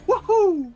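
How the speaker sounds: high, loud squeaky "woohoo"; squeaky whoop. Acted. Character grabbed from above, sudden high squeak. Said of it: "a little squeak as she grabs him".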